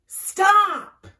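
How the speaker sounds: loud, high, commanding shout; shouted halt. A narrator's reading. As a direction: shout to stop runaways. Bat calls to fleeing animals.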